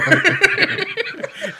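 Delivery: high burst of laughter; big laugh. Real, not acted. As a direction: break out laughing loudly.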